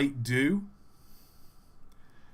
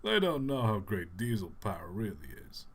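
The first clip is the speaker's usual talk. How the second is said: Patrick Warburton; 5 semitones lower, 8 dB quieter than usual. Acted. Streamer mocking stinky steamies in a bad, low impression. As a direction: drop low for a badly done voice impression. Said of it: "Bad Patrick Warburton impression".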